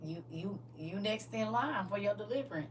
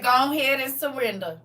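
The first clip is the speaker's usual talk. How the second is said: loud, raised old grandpa imitation; lightly voiced grandpa, half laughing; 4 semitones higher, 10 dB louder than usual. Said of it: "In his old grandpa voice".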